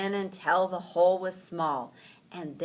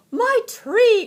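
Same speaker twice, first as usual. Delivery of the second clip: high, loud delighted shout; joyful cry; 15 dB louder than usual. A narrator's reading. Franklin gets his lost tree back, loud and high. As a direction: burst out with joy. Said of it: "cried Franklin".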